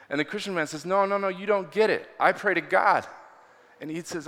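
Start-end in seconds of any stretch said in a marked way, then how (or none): 0.8-3.1 higher, louder frustrated protest; mildly exasperated retelling of an argument; insist, getting worked up; upset insistence. Retold or imitated. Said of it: "got more and more upset".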